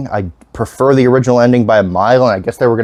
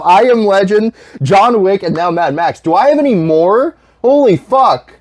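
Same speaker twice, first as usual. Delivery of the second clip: exasperated; 8 semitones higher than usual. Real, not acted. Listing dead dog movies.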